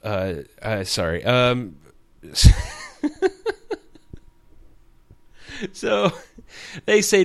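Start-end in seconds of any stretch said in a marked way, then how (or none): 2.2-3.8 high, loud real laugh breaking out; burst of laughter. Real, not acted. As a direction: crack up at yourself. Laughs at own slip.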